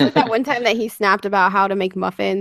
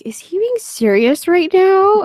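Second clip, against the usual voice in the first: higher, drawn-out baffled question; puzzled disbelief; 7 semitones higher, 3 dB louder, much slower than usual. Retold or imitated. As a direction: wonder slowly, pitch lifting in mild disbelief. Voicing her old puzzlement.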